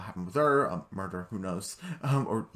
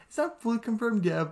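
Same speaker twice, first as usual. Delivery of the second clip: raised, bouncy character voice; high character voice; 11 semitones higher than usual. Retold or imitated. Vlogger voicing the prince announcing news, high pitched. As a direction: lift it high like a modest prince.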